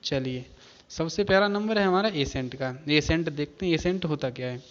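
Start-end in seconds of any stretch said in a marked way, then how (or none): none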